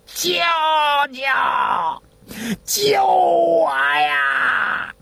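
high, strained, drawn-out cry for help; exhausting every breath in a tearful shout; desperate scream. A narrator's reading. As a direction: wail as if being dragged away. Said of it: "张大郎是声嘶力竭的叫啊".

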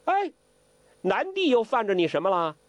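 put-on high, baffled old prankster; puzzled old imp. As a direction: ask it like a wide-eyed rascal. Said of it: "周伯通奇怪".

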